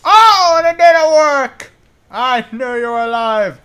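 high, loud yell at an enemy; startled shout. Real, not acted. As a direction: yell out in high-pitched alarm. Game enemy startles player.

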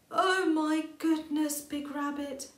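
slow, high, loud gasp of wonder; amazed exclamation. A narrator's reading. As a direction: marvel at the shiny light. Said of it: "Mole exclaimed".

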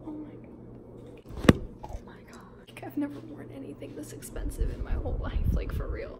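hushed awed whisper; breathless whisper of amazement. Real, not acted. Awed whisper backstage at dress.

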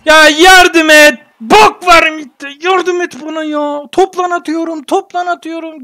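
high, loud plea for help; exasperated yelling. Real, not acted. Gamer begging teammates for help, exasperated yell.

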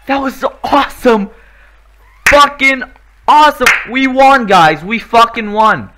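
high, loud, fast cheer; victorious cheering. Real, not acted. Gamer cheering at trailer's end, loud high victory shout. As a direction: celebrate the win.

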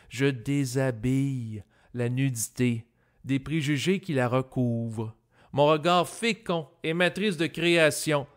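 rhythmic declaimed verse; poetry recital. Acted. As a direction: recite the poem in rising, emphatic phrases. Recites second own poem.

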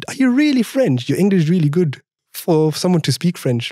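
imitating disbelieving judges, pitch leaping up; high, incredulous retold question; surprised. Judges quizzing a French contestant on his English, surprised.

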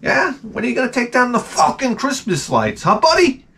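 high-pitched sugary neighbor act; mock pleasantness with irritation poking through; fake friendly. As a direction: put on a chirpy, needling neighbor voice. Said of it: "this facade of friendly".

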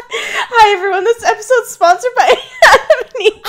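high, loud mock sponsor announcement; mock ad read. Host faking a sponsor read, laughing loud. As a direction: play a cheery radio ad host, laughing.

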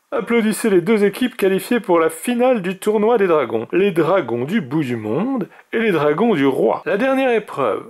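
high, ringing mage announcement; grand declaration. A narrator's reading. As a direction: declare the finalists to the crowd. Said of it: "le grand mage déclara".

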